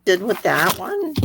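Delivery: a slightly squeaky, strained voice. Real, not acted. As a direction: voice squeaking a little. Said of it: "Sorry, my voice is squeaky tonight".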